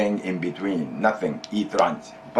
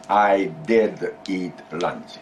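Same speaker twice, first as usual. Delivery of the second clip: slow, deliberate prompt for the student; feed the line slowly to be repeated; 2 semitones lower, much slower than usual. Shown as a demonstration. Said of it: "Go ahead".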